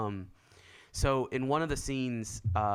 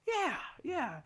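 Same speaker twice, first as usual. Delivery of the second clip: high, softer put-on "yeah"; mock-casual assurance; 5 dB quieter than usual. Teen assuring waiter about the tip, high mock-casual. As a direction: squeak it quietly, pretending it's fine. Acting himself confirming big tip.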